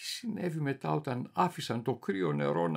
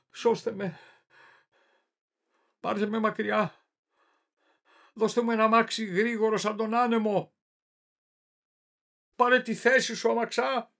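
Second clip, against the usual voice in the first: high-pitched desperate cries for rescue; desperate plea; 10 semitones higher, 7 dB louder than usual. Acted. Tormented madman begging a coachman to carry him away, desperate plea. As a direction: cry out for help, pitch held high, building in urgency.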